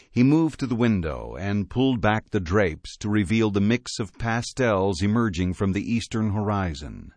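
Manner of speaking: ordinary delivery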